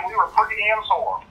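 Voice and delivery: normal talking voice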